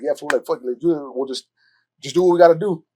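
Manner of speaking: ordinary talk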